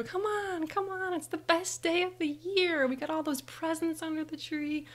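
high, bouncy child voice, acted; excited urging. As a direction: pester your sister with Christmas glee. Acting child bouncing, urging sister.

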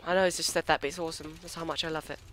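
ordinary talk

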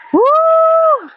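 high loud whoop; gleeful whoop. Real, not acted. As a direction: whoop at the gust. Strong wind hits. (masayang sigaw)

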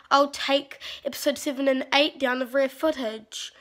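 ordinary talk, nothing marked

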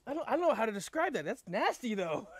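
high, loud grossed-out voice; disgusted. Real, not acted. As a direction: recoil from the sour taste. Disgusted by berry taste.

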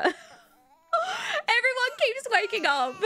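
high, slow, laughing despair; laugh-crying. Real, not acted. Exhausted player laughing at babies waking everyone, laugh-crying. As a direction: laugh helplessly at the chaos.

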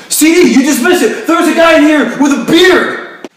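very loud, high frantic shrieking; panicked screaming. Acted. Screaming about an intruder.

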